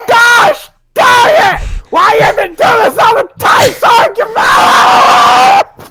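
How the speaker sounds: high, loud exasperated yell; furious shout. Acted. Yelling in frustration at Sergeant Miller, furious shout. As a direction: blow up at the sergeant.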